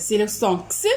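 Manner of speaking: crisp "ks" sound for the letter X. Shown as a demonstration. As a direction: say X as an unvoiced "ks". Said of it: "selon la prononciation".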